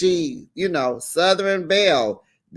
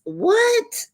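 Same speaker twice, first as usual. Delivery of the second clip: much higher squeak of disbelief; shocked what. Real, not acted. Host reacts to Garcelle naming Jax, squeaking.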